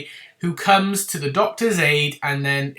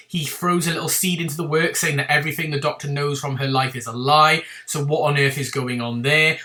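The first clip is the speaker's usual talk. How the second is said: normal talking voice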